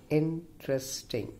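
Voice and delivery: "interesting" broken up as in-tres-ting; syllable by syllable. Shown as a demonstration. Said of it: "Five-syllable word".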